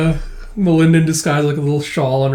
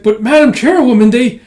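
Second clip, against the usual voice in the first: high, loud flustered objection; pleading protest; 8 semitones higher, 7 dB louder than usual. Retold or imitated. Aide protesting to departing Madam Chairwoman, dismayed.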